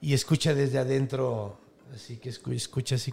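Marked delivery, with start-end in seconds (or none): none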